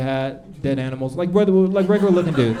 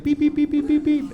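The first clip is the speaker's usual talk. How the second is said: high electronic beeps, much higher; imitated beeper; 12 semitones higher than usual. Comic imitating girlfriend's diabetes beeper going off, high beeps. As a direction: chirp it like a glucose monitor. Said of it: "her, like, little beeper went off".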